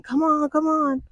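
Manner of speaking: high, called-out mock yell.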